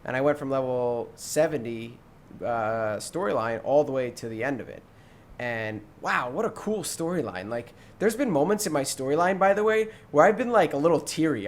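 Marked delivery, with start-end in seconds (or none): none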